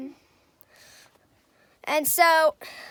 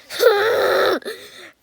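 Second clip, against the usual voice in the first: burst of laughter; 5 semitones higher, 6 dB louder than usual. Real, not acted.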